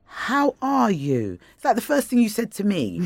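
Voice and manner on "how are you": very slow and higher, each word drawn out; sincere, insistent question. Retold or imitated. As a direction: press gently for a sincere answer, stretching the words. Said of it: "says it to you with sincerity".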